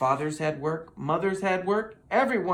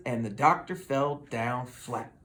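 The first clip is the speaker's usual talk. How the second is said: usual speaking voice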